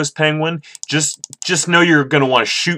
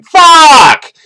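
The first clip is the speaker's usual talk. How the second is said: very high, loud frustrated scream; screaming in frustration; 13 dB louder than usual. Real, not acted. Gamer venting at the screen, frustrated scream. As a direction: scream the curse, pitch shooting up. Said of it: "I'm sitting here screaming".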